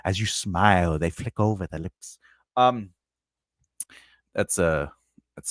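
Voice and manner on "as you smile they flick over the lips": slow, low storyteller voice; low, drawn-out dramatic reading; second-person narration. Shown as a demonstration. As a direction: narrate it like a moody vampire tale, taking your time. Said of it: "Second person".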